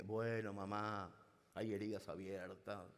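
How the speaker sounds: a hint of a weary grown son; son's voice. Prankster posing as the son to the mother, low put-on voice. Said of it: "le decía yo".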